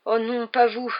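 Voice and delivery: a plaintive moan at an unwelcome helper; dismayed groan. A narrator's reading. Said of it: "gémit-il".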